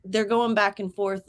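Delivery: usual speaking voice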